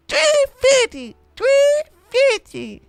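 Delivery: high falsetto meme punchline; squeaky meme voice. Pretend. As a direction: squeak it out like a cartoon.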